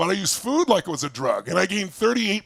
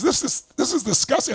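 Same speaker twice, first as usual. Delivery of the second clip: high-pitched mock disgust; appalled; 7 semitones higher than usual. Friend seeing the tiny messy apartment, appalled. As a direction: imitate a friend aghast at the squalor.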